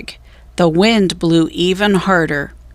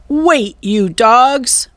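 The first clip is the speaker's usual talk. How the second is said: slow drawn-out yell; 4 semitones higher, 3 dB louder, much slower than usual. A narrator's reading. Captain chasing into water.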